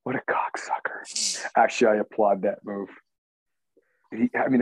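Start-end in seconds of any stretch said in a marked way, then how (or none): 0.0-1.5 slow whispered jab; whispered insult. Real, not acted. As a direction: breathe the insult under your breath, slowly. Muttered insult.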